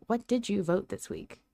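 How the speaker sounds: ordinary delivery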